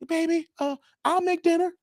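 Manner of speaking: high, sweet put-on wife voice; mock wife voice. Acted couple, wife's reply.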